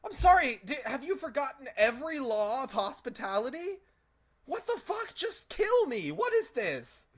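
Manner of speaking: very high, loud indignant screech; outraged shriek. Acted. Captive Fae berates Uller over hospitality, high shrieking outrage. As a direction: shriek your disbelief at the broken hospitality.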